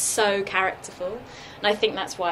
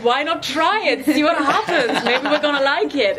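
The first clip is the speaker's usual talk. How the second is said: German drama teacher; 8 semitones higher, 10 dB louder than usual. Retold or imitated. German drama teacher urging shy students to try, loud German accent. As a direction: coax them like a German teacher, louder and higher. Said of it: "he's German".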